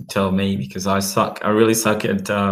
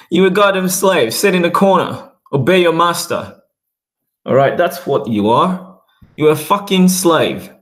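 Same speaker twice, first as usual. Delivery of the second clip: contemptuous rant; 8 semitones higher than usual. Real, not acted. Ranting contempt at slavery narrative.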